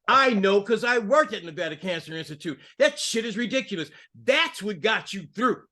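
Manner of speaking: usual speaking voice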